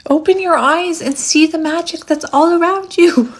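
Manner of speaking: high, swooping theatrical voice; hammed-up mystical urging; dramatic proclamation. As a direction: proclaim it grandly, high and playful. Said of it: "I'm hearing Grandmother Willow from Pocahontas".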